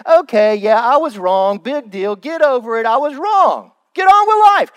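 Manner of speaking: imitating someone who admits fault without remorse, rising to a loud high finish; dismissive mock admission. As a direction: shrug it off, then blurt the last bit. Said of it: "They, they say".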